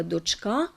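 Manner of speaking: дочка stressed on the final А; stress on ending. Shown as a demonstration. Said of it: "де наголос на закінченні". (наголос на закінченні: дочкА)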